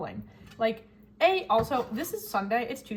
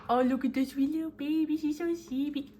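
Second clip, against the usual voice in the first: put-on higher, cooing baby talk voice; baby talk to the cat; 5 semitones higher than usual. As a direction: coo at the sleepy kitten like a baby. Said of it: "I was baby talking the cat".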